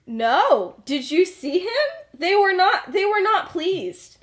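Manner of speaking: high, loud retold protest; emphatic disagreement. James telling Remus the date went badly, emphatically. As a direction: act out an adamant contradiction, hitting each NOT. James disputes that they were pleased.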